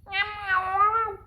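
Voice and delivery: very high, loud cat meowing; loud squeaky imitation of a cat's meow. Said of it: "And the cat goes".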